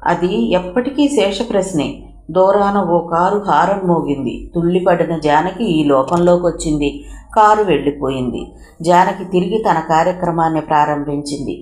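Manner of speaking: ordinary delivery, nothing marked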